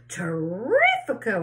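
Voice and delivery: slow, relished cry of delight; gleeful cry. A narrator's reading. Aunt Sponge cries out greedily at the peach, slow and relished. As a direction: savour the word greedily. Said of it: "Aunt Sponger cried".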